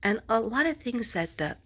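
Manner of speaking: ordinary talk, nothing marked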